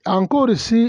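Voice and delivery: normal talking voice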